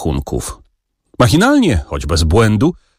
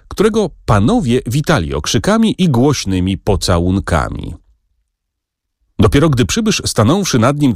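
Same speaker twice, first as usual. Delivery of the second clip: plain reading voice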